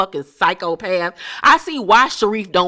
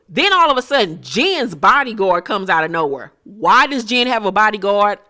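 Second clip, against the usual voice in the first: ordinary talk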